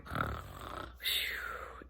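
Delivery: long whispered lazy sigh; sleepy sigh. Acted. Dog sighs back into sleep, whispered. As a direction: sigh off into a nap.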